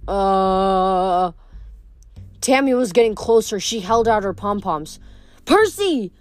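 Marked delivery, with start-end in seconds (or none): 0.1-1.3 dazed. A narrator's reading. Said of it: "something really intelligent like". 5.5-6.1 high, sharp calling out; urgent call. A narrator's reading. Rachel calls urgently.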